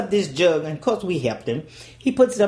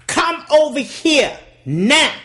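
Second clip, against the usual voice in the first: higher, louder, slow stern order; daddy's command; 9 semitones higher, 6 dB louder, slower than usual. Retold or imitated. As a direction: spell it out, furious father. Angry daddy summons child.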